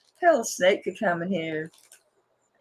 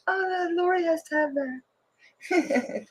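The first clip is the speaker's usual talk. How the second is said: high, slow, delighted squeal; gushing self-quote; 12 semitones higher, 4 dB quieter, much slower than usual. Retold or imitated. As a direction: coo it like you found the perfect gift. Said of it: "I'm like".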